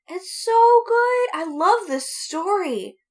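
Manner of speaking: high, loud, slow gush. Real, not acted. Host gushing about a story podcast, high and slow. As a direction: gush like you adore it.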